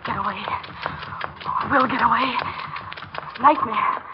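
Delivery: low, hushed self-talk; frightened muttering. Acted. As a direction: mutter it under your breath. Hiding alone in the dark.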